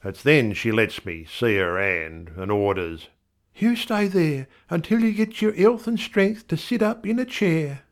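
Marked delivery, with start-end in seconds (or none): 3.5-7.8 much higher wife voice; a firm motherly wife, higher; wife's voice. A narrator's reading. Wife ordering her husband to stay, higher.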